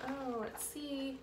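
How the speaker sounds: plain reading voice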